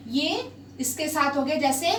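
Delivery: ordinary talk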